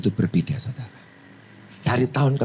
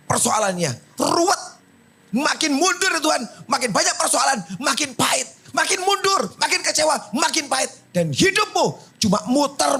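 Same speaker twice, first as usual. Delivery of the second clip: full-force sermon climax; fiery preaching. Acted. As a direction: preach it out to the congregation.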